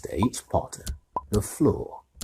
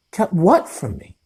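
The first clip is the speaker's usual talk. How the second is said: eager; 12 semitones higher, 7 dB louder than usual. A narrator's reading. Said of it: "said Harry eagerly".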